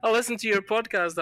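high-pitched retold fan recognition; excited listener. Fellow passenger recognising the podcast host, imitated excitedly. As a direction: imitate a thrilled stranger, pitched well up.

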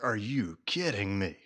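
stunned retold disbelief, dropped low and soft; quietly floored, words falling away; speechless. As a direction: re-enact being too amazed to project. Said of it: "I'm back there just speechless".

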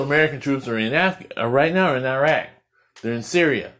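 ordinary delivery

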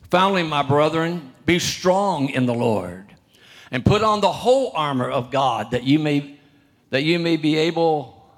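loud, measured Bible reading; preacher reading the King James aloud; scripture reading. Acted. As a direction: proclaim the verse to the congregation.

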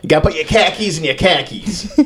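high, exaggerated Boston caricature; mock Boston accent. Host retrying Boston after missing it, mock Boston accent. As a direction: ham up a Boston accent, pitched high and amused. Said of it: "but it was not Boston".

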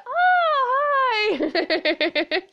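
high, drawn-out "oh, hi"; mock-starstruck greeting breaking into laughter; gushing greeting. Acting out reaction to being noticed.

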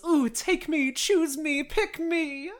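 high-pitched eager begging; mock over-keen volunteer, voice jumping up high; eager pleading. Petya begs Dolokhov to take him along, eagerly.

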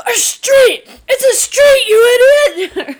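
high, loud exasperated shout; dad's yell. Retold or imitated. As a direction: imitate dad yelling at the song. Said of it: "My dad would always yell".

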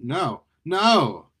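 escalating panicked refusal; desperate protest. Acted. Eddie realizes he is being trapped.

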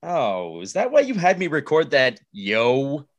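ordinary delivery

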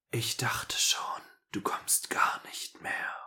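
soft breathy whisper. A narrator's reading. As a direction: whisper it close in the dark. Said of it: "flüsterte er".

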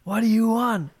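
high, exaggerated meme boyfriend asking; imitating the Ryan Gosling meme's exasperated question. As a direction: pitch it up, mocking the clip. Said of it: "basically it's like".

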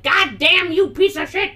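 rough man cursing out his dog; angry villain. Acted. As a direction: spit the curses at the dog. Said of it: "the antagonist is like".

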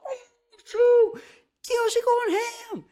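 very high, squealing fan cheer; retold excited yell. Fan retelling their reaction to the show, yelling out excitedly. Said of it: "like, yelled out".

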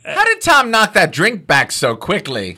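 loud, slow mock-British exclamation; hammed-up British accent, stretched out. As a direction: put on a high British accent.

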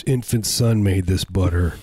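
normal talking voice

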